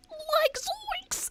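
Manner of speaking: a quick goofy stab at a cartoon hippie; a hint of a cartoon stoner exclaiming; Shaggy attempt. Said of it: "I can't do a fucking voice".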